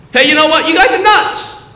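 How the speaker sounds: high, loud, exasperated exclamation; acted outburst of disbelief; exclaimed call-out. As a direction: call them out at a high pitch. Voicing Jesus calling people out.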